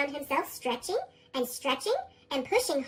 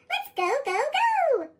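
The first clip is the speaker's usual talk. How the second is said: rising eager cheer; 8 semitones higher than usual. Acted. Acorn cheering to the squirrel, climbing to a high cheer. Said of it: "Acorn cheered".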